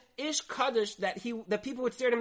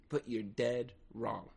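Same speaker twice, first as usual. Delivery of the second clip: low dropped punchline; 10 semitones lower, 10 dB quieter, slower than usual. Real, not acted. Punchline of the lesson.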